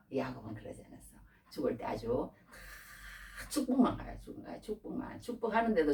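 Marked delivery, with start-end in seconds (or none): 0.1-1.2 quiet repeat. Real, not acted.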